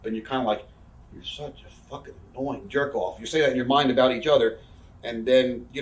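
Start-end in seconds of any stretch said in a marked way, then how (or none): 1.1-3.2 softer voice, as an inner thought; muttered annoyance. Retold or imitated. Thinking it about a partner, muttered annoyance. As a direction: grumble it privately under your breath.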